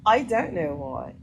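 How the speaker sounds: ordinary talk, nothing marked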